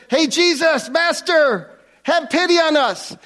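called out high and loud across a distance. Retold or imitated. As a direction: shout it across sixteen feet. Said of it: "They said, in a loud voice".

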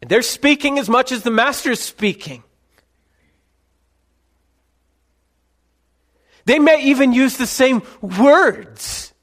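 high, loud warning from the pulpit; forceful preaching. Real, not acted. Preacher warning the church about false voices, loud high preaching. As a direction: press each phrase out urgently, pausing between.

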